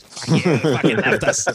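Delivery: mock Australian jeer. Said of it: "the Australians are like".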